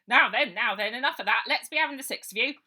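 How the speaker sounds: loud, fast policeman's voice; old sergeant herding the children; bluff old copper. Acted. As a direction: settle them down officiously. Old copper restoring order.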